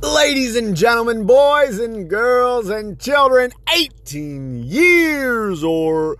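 booming ringmaster announcement, high and slow. Acted. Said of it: "I like to put that little dramatic pause there".

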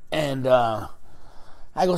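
normal talking voice

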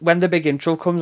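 ordinary talk

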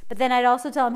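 ordinary delivery

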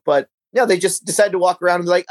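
ordinary delivery

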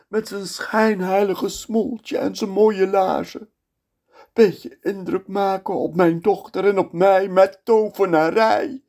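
high bitter lament of the sultan. Acted. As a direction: brood like a betrayed old father. Sultan's voice in monologue.

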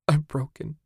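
high, sudden cry of despair; blurted despair. A narrator's reading. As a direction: blurt it out, broken. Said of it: "she blurted".

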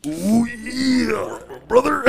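high raspy wrestler catchphrase; Macho Man. Retold or imitated. Teasing his coughing friend about smoking, raspy wrestler impression. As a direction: put on a strained, raspy showman's drawn-out cry. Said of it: "That raspy ass voice".

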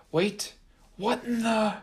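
high, sudden puzzled cry; startled. A narrator's reading. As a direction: caught off guard mid-gloat. Haint surprised by the box.

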